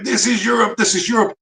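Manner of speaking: high-pitched imitation of a man screaming; mock scream. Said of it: "the guy's screaming".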